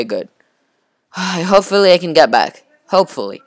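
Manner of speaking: usual speaking voice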